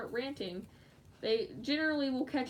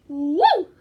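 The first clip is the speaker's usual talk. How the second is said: sudden high, very loud "woo"; whooping scream; 6 semitones higher, 17 dB louder than usual. Real, not acted. Excited while crafting, a loud high whoop. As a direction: let out one piercing whoop. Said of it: "Like me screaming".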